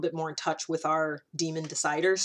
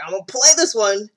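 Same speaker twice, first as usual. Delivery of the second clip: bold quote; 4 semitones higher, 11 dB louder than usual. Retold or imitated. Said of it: "you're like".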